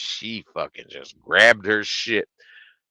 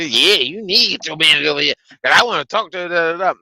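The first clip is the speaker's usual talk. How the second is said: high, loud, fast mock demand; hammed-up, pushy complaining voice; mimicked demanding customer; 8 semitones higher, 7 dB louder, faster than usual. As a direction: imitate a customer demanding the manager.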